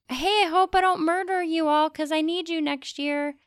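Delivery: cheerful mock plea to the bugs; mock farmer voice. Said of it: "the farmers just saying".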